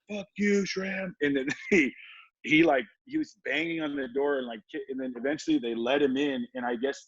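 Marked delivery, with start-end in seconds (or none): 0.1-1.1 mocking the coach. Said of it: "And they were like".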